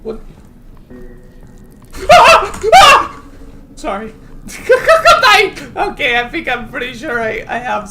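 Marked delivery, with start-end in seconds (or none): 0.3-3.7 loud laughing fit; loud burst of laughter. Real, not acted. Laughing at centipede maze. 4.2-5.7 loud laughing fit; loud laughter. Real, not acted. Still laughing.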